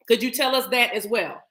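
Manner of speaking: ordinary talk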